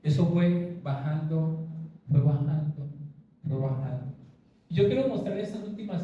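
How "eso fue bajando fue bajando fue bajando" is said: slowing down, sinking gradually to a low finish; gradual descent. Shown as a demonstration. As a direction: let each repetition sink in pitch and fade. Illustrates gradual lessening of symptom.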